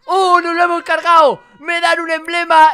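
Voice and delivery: loud, high victory cry; triumphant shout. Real, not acted. Gamer cheering as the witch is defeated, triumphant shout. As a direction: yell it out in real triumph.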